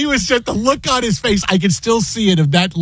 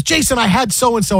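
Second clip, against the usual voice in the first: higher-pitched mock complaint; Michael Rappaport; 5 semitones higher than usual. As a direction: imitate an upset co-host starting a gripe. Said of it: "I haven't seen you this upset".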